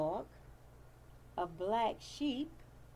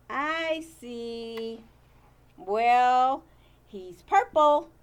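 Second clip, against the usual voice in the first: plain reading voice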